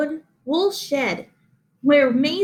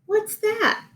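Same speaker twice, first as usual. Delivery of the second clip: much higher, a little pig's squeak of wonder; excited piggy squeal; 10 semitones higher than usual. A narrator's reading. Henry the pig spots a shape in the stars, squealing. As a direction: squeal it like an amazed piglet. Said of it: "he squealed".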